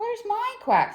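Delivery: high-pitched duckling question; small duckling, high voice; little duckling. A narrator's reading. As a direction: ask in a high baby-duck voice. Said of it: "said Little Duckling".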